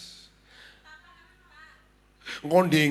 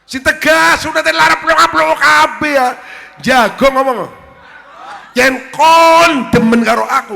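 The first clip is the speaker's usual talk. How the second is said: higher and much louder, furious scolding; angry shout; 9 semitones higher, 11 dB louder than usual. Acted. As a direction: yell at him to be firm. Scolding husband in the quarrel. (nesu karo bengok-bengok)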